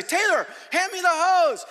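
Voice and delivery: high, loud acted demand; urgent holler. Man under the smoky porch to Taylor, urgent high holler.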